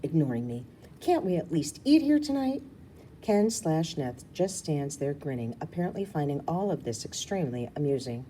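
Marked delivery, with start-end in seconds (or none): 1.0-2.6 much higher pleading whine; harumphing plea. Acted. Rue pleading to eat at the resort, whiny. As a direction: whine it with a pout.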